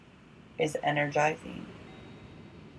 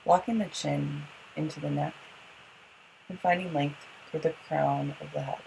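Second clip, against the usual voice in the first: guided yoga instruction; 4 dB quieter, faster than usual. Acted. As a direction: guide the breathing gently, unhurried.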